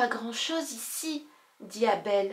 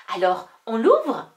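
impatient; 10 dB louder than usual. Acted. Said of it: "très impatiente".

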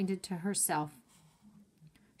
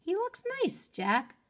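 much higher, sweet and delighted; delighted Annie; 11 semitones higher than usual. Acted. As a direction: coo it like she adores him.

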